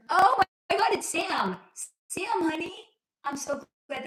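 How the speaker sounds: high, loud squeal of delight; excited greeting. Real, not acted.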